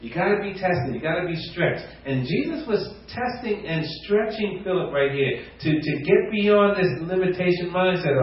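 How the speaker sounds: normal talking voice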